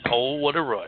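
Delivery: Animal's catchphrase. Retold or imitated. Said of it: "He says".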